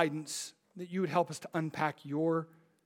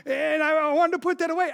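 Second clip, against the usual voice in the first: put-on higher, whiny little boy's voice; kid's excuse; 9 semitones higher, 7 dB louder, faster than usual. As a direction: plead your case like a child. Son Titus voiced.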